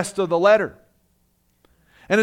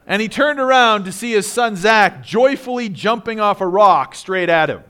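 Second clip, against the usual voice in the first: ordinary talk, nothing marked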